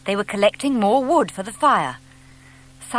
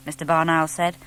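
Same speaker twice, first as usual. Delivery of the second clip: ordinary delivery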